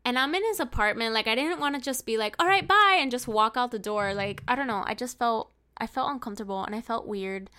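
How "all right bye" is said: loud, high, pretend breezy farewell; mock abrupt goodbye. Her imagining walking straight out the door, loud abrupt goodbye. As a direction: blurt it out much louder and higher.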